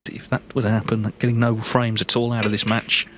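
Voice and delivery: ordinary delivery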